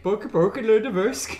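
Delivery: sing-song mock Norwegian; a lightly put-on Norwegian lilt; mock Scandinavian gibberish; Norwegian accent. Shown as a demonstration. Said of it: "my Norwegian accent".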